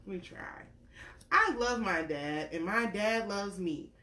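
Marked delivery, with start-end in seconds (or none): 1.3-3.9 gentle storybook read-aloud; reading to a child. Acted. Parent reading a picture book to a little one, gentle storytelling.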